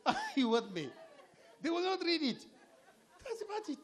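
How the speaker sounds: high giggly delivery through laughter; laughing as you talk. Real, not acted. Speaker laughing with the audience at his joke, laughing. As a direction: crack up while getting the words out.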